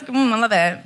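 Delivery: normal talking voice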